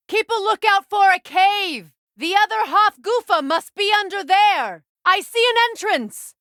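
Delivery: high, loud call over distance. Acted. Guide calling to Kratos while searching the desert for a cave, loud call. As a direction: shout ahead to your companion across the sands.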